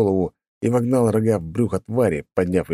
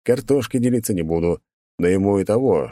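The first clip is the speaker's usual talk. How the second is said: ordinary delivery, nothing marked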